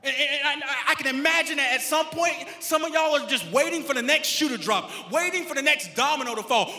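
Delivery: loud, punchy preacher with stretched key words; full-force preaching. Real, not acted. Pastor telling sufferers there is a purpose, loud preaching. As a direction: drive each phrase home to the congregation.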